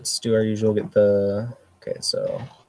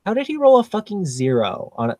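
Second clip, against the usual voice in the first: incredulous; 9 semitones higher than usual. Real, not acted. Bad die roll outrage.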